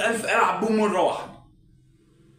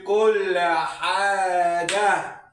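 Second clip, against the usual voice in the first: slow, stretched lament; wailing lament; 3 semitones higher, much slower than usual. Acted. Husband confessing to his wife, slow wailing lament. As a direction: drag the words out in despair.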